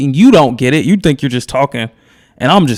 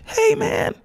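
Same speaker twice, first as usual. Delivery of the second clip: very high voice, half laughing; mock loving reply; slower than usual. Host answers an acted angry man, high playful love. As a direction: disarm them in a squeaky high register.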